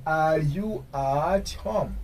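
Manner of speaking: "Are you at home" very drawn out, falling at the end; slow model question. Shown as a demonstration. Teacher modelling "Are you at home" for learners, very slow.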